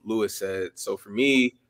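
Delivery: normal talking voice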